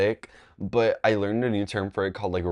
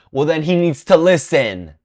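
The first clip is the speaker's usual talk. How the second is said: loud, raised-pitch scolding; mean teacher; 8 semitones higher, 10 dB louder than usual. Retold or imitated. Teacher dismissing the girl's alarm, mean teacher. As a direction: imitate a teacher scolding sternly and loudly. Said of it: "that teacher was mean".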